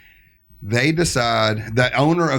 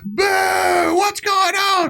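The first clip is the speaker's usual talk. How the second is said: high mock booing crowd; mock crowd shout; slower than usual. Mimicking the festival crowd booing, high shouted. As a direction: yell it like an angry crowd. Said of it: "people in the crowd going".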